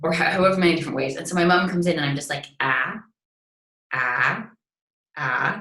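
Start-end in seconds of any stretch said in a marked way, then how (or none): 2.6-5.6 separate, held AH sounds, each shaded differently; low, deliberate AH drills with gaps between; practicing a vowel. Shown as a demonstration. Actor practicing at home as mum walks in, vowel drill on AH. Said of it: "practicing a vowel".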